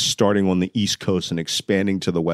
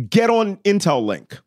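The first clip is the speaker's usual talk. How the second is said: urgent orders; 12 semitones higher than usual. Retold or imitated. Retold urgent orders by phone.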